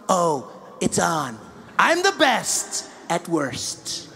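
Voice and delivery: very slow, smug Trump voice; Trump impression. Trump taunting Melania, very slow smug impression.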